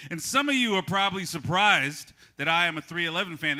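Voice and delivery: usual speaking voice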